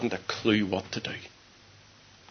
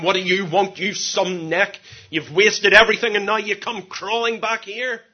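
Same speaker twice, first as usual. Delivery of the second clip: a hint of an indignant father, raised voice; mock scolding father; 6 semitones higher, 6 dB louder than usual. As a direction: act out a scornful telling-off. Imagined father scolding returning son.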